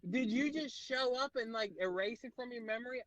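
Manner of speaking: high, exasperated disbelief; mock outrage at a clueless friend; incredulous. Comic teasing his friend about the vaccine date, exasperated.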